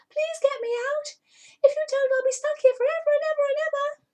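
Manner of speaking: plead, tumbling the words out; frantic pleading cat. Acted.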